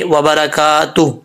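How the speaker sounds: slow व बरकातुहू, long "kaa", closing on a vowelless h; correct stop. Shown as a demonstration. Teacher showing the silent-vowel stop, careful recitation. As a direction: end व बरकातुहू in "tuh", no final "hoo". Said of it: "हा को भी साकिन कर देंगे".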